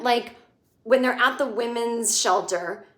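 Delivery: usual speaking voice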